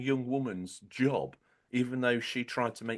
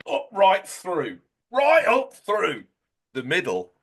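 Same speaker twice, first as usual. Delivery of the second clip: rising excited shout; 8 semitones higher, 10 dB louder, slower than usual. Real, not acted. Climax of the exaggerated route.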